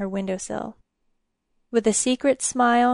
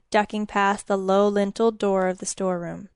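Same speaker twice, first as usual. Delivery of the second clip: ordinary delivery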